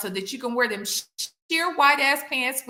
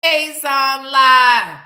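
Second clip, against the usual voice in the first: loud slow call-out; 2 semitones lower, 8 dB louder, much slower than usual. Real, not acted. Calling out a lie.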